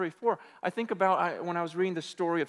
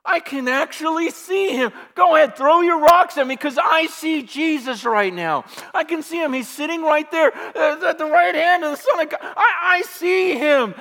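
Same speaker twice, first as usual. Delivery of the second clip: loud, high, near laughing and tears; overwhelmed with awe, crying out; ecstatic vision; 9 semitones higher, 12 dB louder than usual. Retold or imitated. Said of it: "kinda freaks out at that moment".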